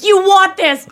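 escalating, emphatic quote of an outburst; losing it. Retold or imitated. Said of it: "lost it".

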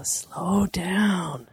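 slow, drawn-out stage whisper; whispered coaching. Retold or imitated. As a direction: whisper the answer to him slowly. Voicing whispered coaching in retold scene.

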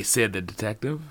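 ordinary delivery, nothing marked

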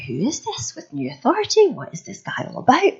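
hammed-up bewilderment with swooping pitch; puzzled crowd. Voicing the puzzled synagogue crowd.

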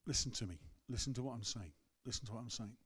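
gentle voice. Retold or imitated. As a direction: coax softly like a patient friend. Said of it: "just gently going".

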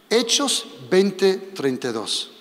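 each word spaced out for writing; measured reading out of a verse reference; slow dictation. Shown as a demonstration. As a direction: dictate it slowly so they can note it.

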